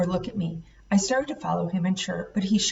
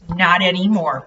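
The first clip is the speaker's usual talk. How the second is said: snapped; 8 dB louder than usual. A narrator's reading. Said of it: "Burke snapped".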